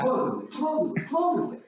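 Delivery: sing-song high teasing repeat; high mocking disbelief; mock skeptical. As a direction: tease him with a squeaky high supposedly. Teasing doubt about driver's story.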